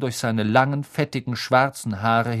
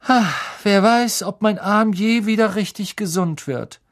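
high, louder mock lament; fake sighing Malfoy; 9 semitones higher, 4 dB louder than usual. A narrator's reading. Malfoy feigns worry over his arm, high false lament. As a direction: sigh falsely and bemoan your arm. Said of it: "einen langen, falschen Seufzer".

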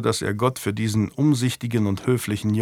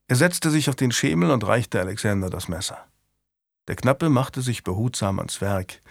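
ordinary delivery, nothing marked

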